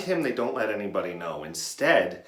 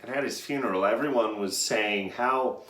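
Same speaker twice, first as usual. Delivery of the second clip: ordinary delivery, nothing marked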